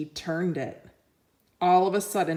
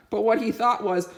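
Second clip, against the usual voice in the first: ordinary delivery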